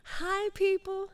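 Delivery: high, loud, stretched-out hello; excited greeting. Real, not acted. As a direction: sing out the hello. Host greeting the audience.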